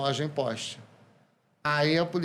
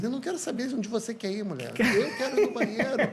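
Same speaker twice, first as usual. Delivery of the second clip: higher, fast, laughing exasperated outburst; nervous outburst; 7 semitones higher than usual. Retold or imitated. Retelling his outburst at the waitress, mock nervous and laughing. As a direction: blurt it out nervously. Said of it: "quando você fica nervoso".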